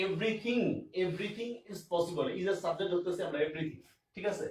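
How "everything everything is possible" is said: very slow, spelled-out reading; slow dictation. Shown as a demonstration. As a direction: drag each word out for the class. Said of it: "teacher repeats example slowly".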